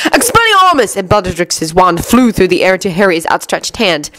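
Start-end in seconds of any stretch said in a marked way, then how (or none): 0.1-1.0 high-pitched bellowed spell. A narrator's reading. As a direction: call the spell out forcefully. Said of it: "Ron bellowed".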